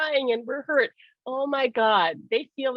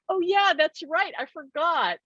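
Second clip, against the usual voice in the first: higher-pitched retold oh-yeah moment; sudden realisation; 7 semitones higher than usual. Reminded by her friend about spirit, sudden realisation. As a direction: lift the voice as it dawns on you.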